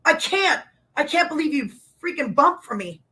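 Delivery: high, thrilled squeal of disbelief; excited disbelief. Retold or imitated. Wrestler retelling her delight when Flair took her bump, high. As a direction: gush like a starstruck fan. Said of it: "And I go".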